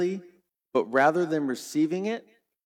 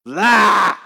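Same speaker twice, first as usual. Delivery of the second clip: loud, high mock roar of rage; a furious crowd's roar; angry roar; 8 semitones higher, 13 dB louder than usual. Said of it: "They are now just".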